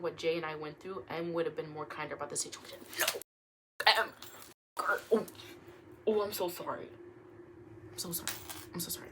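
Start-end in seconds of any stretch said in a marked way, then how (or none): 3.0-9.1 softer sorry, fading out; hushed apology. Real, not acted. Apologising after an outburst, hushed and fading. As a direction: murmur the apology, trailing off. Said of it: "I'm cutting that out".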